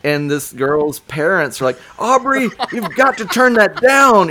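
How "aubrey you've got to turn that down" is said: high, loud yell at the daughter; parent yelling. Retold or imitated. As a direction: shout her name up the stairs.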